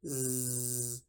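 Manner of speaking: Zzz, a soft, buzzing z like a mosquito. Shown as a demonstration. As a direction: let the Zzz buzz like a mosquito. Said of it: "vibrar como un mosquito".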